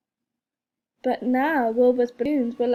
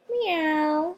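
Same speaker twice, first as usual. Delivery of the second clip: higher cat meow; 5 semitones higher than usual. A narrator's reading. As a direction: mew like a sleepy cat. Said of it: "said Wilbur".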